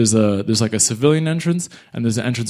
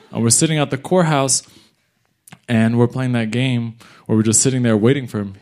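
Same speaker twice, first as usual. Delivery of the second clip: ordinary talk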